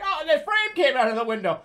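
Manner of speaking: high, loud mock-mom wail; panicked mother. Mother left holding the window frame, hammed-up high alarm. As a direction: cry out as a flustered mother. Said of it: "and she's like".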